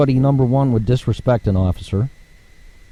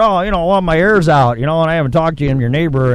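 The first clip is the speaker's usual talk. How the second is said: higher-pitched mock neighbor; mocking, higher-voiced request; imitated pestering neighbor; 6 semitones higher, 4 dB louder, faster than usual. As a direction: mimic a neighbor angling for a favor. Mimics neighbor asking off-duty favors.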